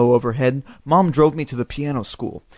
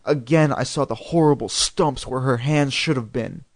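usual speaking voice

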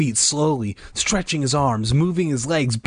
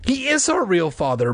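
higher-pitched firm contradiction; emphatic, raised-pitch denial; insistent; 8 semitones higher than usual. A narrator's reading. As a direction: insist against a brother's doubt. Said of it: "Margaret insisted".

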